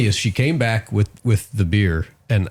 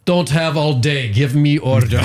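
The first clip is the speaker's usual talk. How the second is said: put-on curt, slow Russian-accented order, pitched up; impatient Russian lady; Russian waitress; 5 semitones higher, 4 dB louder, slower than usual. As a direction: bark it out in a thick Russian accent. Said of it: "Little, little Russian lady".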